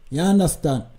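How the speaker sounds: ordinary talk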